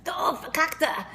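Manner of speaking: slow imitation of grandma's German-accented "Du verkackte"; grandma's German curse. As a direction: drag out "Du verkackte" slowly, in the accent of a European grandmother scolding a driver. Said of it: "my grandmother said".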